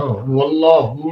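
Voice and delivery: تلاوت کے انداز میں; Quran recitation. Shown as a demonstration. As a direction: intone the verse slowly. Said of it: "جو ہا آئی ہے".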